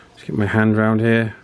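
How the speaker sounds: usual speaking voice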